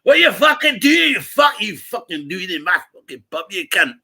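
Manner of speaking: mock-furious Scottish pub regular, yelling; hammed-up rage at English drinkers, shouting at its peak; furious local. A pub regular roars at English lads ordering lager, furious shout.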